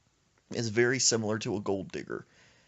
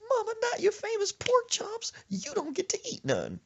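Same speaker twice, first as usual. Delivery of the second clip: high whining grandson. Retold or imitated. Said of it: "He was like".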